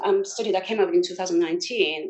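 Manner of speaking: normal talking voice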